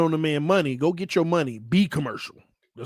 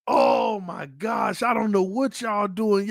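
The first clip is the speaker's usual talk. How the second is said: usual speaking voice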